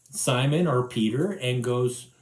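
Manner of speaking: ordinary talk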